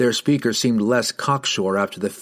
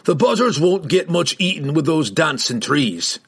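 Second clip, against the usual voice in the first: higher, relishing dwarf taunt; 6 semitones higher than usual. A narrator's reading. Dwarf taunts mounted riders, gruff relish. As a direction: taunt them as a battle-ready dwarf.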